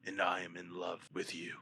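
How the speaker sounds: put-on slow, weighty Russian-accented speech; grave Russian accent, fading to a low confession; Russian accent with gravitas. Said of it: "But I got the gravitas".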